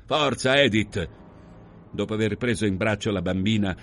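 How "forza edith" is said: slow, higher-pitched urging; firm command. Acted. Husband at open door urges wife out, firm command. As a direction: order her along firmly.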